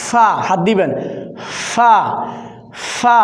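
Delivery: ফা with a light puff of air on the lip; Arabic letter fa. Shown as a demonstration. Teacher asking learners to feel the air on their hand. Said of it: "হালকা বাতাস বের হবে".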